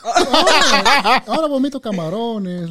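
diciendo entre carcajadas; riendo. Real, not acted. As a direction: se ríe mientras lo cuenta. Risa al contar que vomita. (riéndose a carcajadas)

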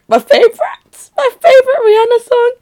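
very high-pitched gushing; excited squeal. Real, not acted. Announcing a favorite [name] song, excited squeal. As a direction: squeal it like an overexcited fan.

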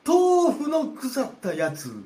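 high, slow, loud astonished repeat; shocked disbelief. Acted. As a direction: echo it back, aghast and slow.

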